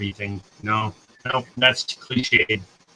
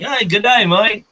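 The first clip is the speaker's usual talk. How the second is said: high, loud mock Australian greeting; playful, overdone Australian accent; 9 semitones higher, 5 dB louder than usual. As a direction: put on a try-hard Australian accent. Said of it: "I feel like I sound like the accent".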